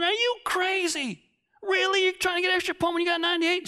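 high, exasperated disbelief, rushing at the end; as if she has lost her mind; incredulous. Retold or imitated. Incredulous thought about Beverly.

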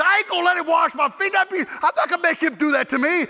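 high-pitched mock protest; indignant refusal. Shane refusing to let him wash his feet, acted high protest. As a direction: balk at it, pitched well up.

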